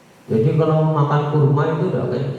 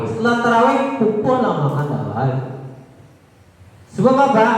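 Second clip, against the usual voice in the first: raised, high-pitched sermon voice with pointed questions; fervent preaching; 7 semitones higher than usual. Acted. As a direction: preach it up to the congregation. (ceramah berapi-api dengan nada tinggi)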